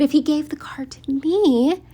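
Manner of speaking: mocking whisper of his logic. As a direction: whisper it like his dumb idea. Mocking Nick's pea brain.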